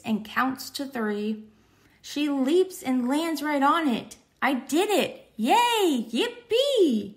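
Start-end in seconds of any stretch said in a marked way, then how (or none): 4.4-7.2 high, bouncing cries of joy; gleeful cheer. Acted. Luna celebrates landing on the shooting star, high gleeful cheering. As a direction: squeal it like a kid who just won.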